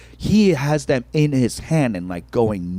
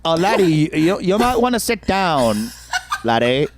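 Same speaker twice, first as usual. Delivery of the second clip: mock Irish accent, pitched up; botched Irish accent; 5 semitones higher than usual. Club owner advising the waiting comic, botched Irish accent. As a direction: have another bad go at an Irish accent. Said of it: "I'm gonna fuck up this Irish accent".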